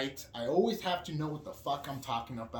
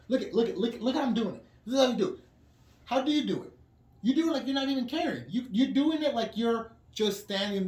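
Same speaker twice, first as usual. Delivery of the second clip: higher, louder, fast impatient lecturing; irritated scolding; 8 semitones higher, 4 dB louder, faster than usual. Retold or imitated. Retelling how he scolded Annabelle over the table, exasperated and raised. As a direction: scold her for sloppy wiping. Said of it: "I blew up on her".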